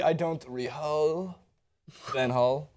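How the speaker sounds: slow mock Dutch accent, hard G softened to H; Van Gaal's accent. Shown as a demonstration. Host retries the Van Gaal impression, slow mock Dutch accent. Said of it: "Say it in Van Gaal's accent".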